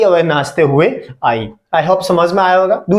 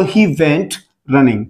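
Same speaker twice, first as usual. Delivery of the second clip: slow, words drawn apart for the class; slow classroom repetition; slow model answer; much slower than usual. Shown as a demonstration. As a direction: dictate it slowly. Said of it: "teacher models English answer".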